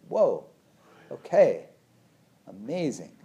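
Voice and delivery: high, loud amazed whoa; mock wonder. Noticing her own anger, voicing amused amazement. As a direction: marvel at the sparks.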